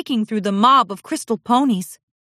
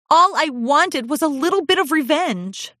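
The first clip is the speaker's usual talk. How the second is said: higher, lightly voiced pouting filly; sulky filly; 9 semitones higher, 3 dB louder than usual. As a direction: admit it with a sulk. Olive confessing.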